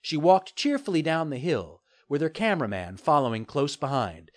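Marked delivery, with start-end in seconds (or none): none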